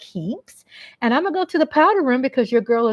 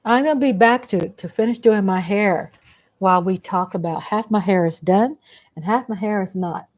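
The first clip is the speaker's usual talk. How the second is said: ordinary talk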